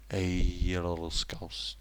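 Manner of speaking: slow, soft mock Mancunian; attempted Mancunian. Having another go after slipping into Scouse, soft Mancunian attempt. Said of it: "Mancunian's very different".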